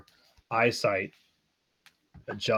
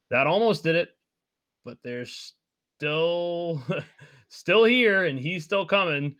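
higher-pitched, emphatic character voice; Randon figure, urgent; 7 semitones higher, 4 dB louder than usual. Acted. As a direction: press the warning in a raised voice.

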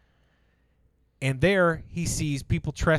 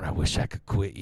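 quoting Brokeback Mountain; 8 semitones lower than usual. Retold or imitated. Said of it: "I just kept going".

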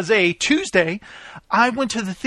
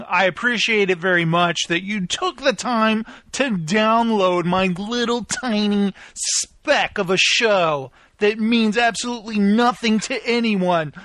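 ordinary talk, nothing marked

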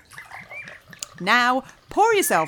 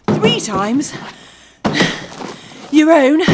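grunting under a heavy load; straining; 7 semitones higher, 4 dB louder, slower than usual. Acted. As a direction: strain and puff like hauling bags. Lugging heavy load five miles.

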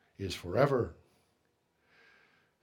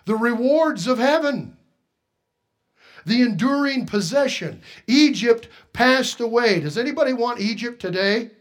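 loud, high preaching; rising pulpit cry; 7 semitones higher, 7 dB louder than usual. Real, not acted. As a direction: preach it out with fire. Preaching at full force.

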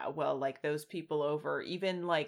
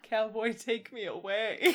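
louder, yearning western sigh; dramatic cowboy plea; 5 semitones higher, 6 dB louder than usual. Pretend. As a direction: plead to the open range.

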